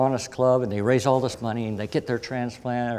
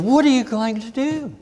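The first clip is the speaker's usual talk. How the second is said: mad doctor; 8 semitones higher than usual. Retold or imitated. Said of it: "she got so mad at me".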